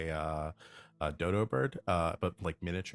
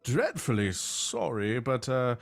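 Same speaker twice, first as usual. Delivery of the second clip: fussy nobleman through pelican; 6 semitones higher, 5 dB louder than usual. Acted. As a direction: gush the apology in a lofty high voice. Pelican relays Baron Steele's voice.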